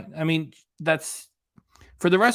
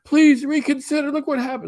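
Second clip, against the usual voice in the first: much higher, louder whiny fan voice; mock pleading fan; 14 semitones higher, 6 dB louder than usual. Vlogger mocking fans writing to the WWE, high whiny plea. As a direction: beg like a gullible wrestling fan. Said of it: "letters saying".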